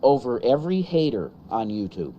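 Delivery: loud preaching blessing; prayer. Acted. Prays blessing over viewers.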